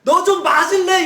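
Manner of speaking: high, loud angry yell; furious threat. Pretend. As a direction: blow up at him in rage. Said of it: "확 올라오셔가지고". (하나님이 요나에게 버럭 화내는 척, 높고 크게 윽박지르듯)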